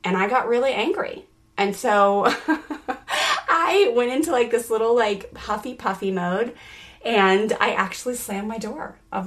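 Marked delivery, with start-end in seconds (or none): 2.4-3.4 short high burst of laughter; amused high giggle. Real, not acted. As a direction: laugh at yourself, high and quick. Laughing at herself.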